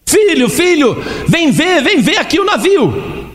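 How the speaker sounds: chamado agudo e insistente; chamando o filho. Acted. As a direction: chama o filho para ver o navio.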